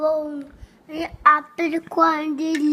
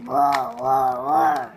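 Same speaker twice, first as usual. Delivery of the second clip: dinosaur roar; 4 dB louder, slower than usual. Pretend. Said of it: "What does the dinosaur say?".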